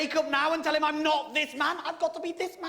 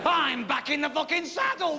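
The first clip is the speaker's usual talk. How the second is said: normal talking voice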